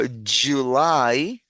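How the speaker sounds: "July" very slow with a clear "dj"; English J sound. Shown as a demonstration. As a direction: stretch "July" out as a model word.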